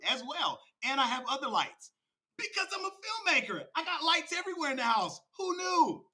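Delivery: high, loud, showy bragging; mock-triumphant boasting. Real, not acted. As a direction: crow it, pitch climbing to the top. Mock boasting about backup lights.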